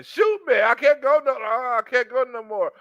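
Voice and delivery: mock exhausted plea. Man begging her to shoot him, high worn-out plea. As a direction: beg like you're spent.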